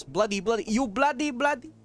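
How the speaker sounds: angry Indian man. Acted. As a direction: imitate an angry Indian man, pitch rising sharply. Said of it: "a very angry Indian man says".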